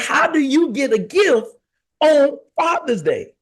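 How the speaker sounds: high-pitched, exasperated question; incredulous protest. Retold or imitated. Husband questioning wife's gift on Father's Day, incredulous. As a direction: ask it high, in mock disbelief.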